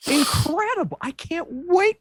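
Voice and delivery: high-pitched, awed delight; thrilled fan gushing; gushing excitement. Real, not acted. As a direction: let the voice leap up with anticipation. Gushing about the coming movie.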